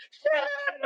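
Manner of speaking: very high, held scream; comic high-pitched yell of panic; panicked shriek. Retold or imitated. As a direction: act out screaming while on fire. Retold scream while burning.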